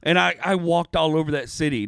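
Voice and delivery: ordinary talk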